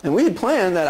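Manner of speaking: normal talking voice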